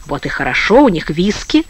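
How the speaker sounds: usual speaking voice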